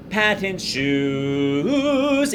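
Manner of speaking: "patent shoes" sung up high and held very long; up the octave. Shown as a demonstration. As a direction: lift "shoes" up the octave to make it pop. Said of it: "get up the octave to make it more exciting".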